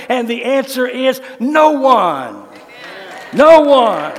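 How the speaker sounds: fiery preaching. Acted. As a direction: proclaim the psalm with full force. Preaching at full force.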